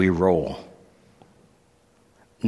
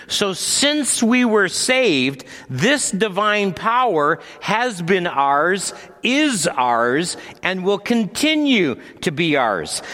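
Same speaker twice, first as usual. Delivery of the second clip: preaching; 10 semitones higher than usual. Acted. Preaching passage on divine power.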